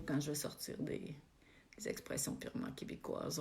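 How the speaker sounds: confiding whisper; playful whispered aside; whispered joke. Real, not acted. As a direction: whisper it like a secret. Jokes about Québécois expressions.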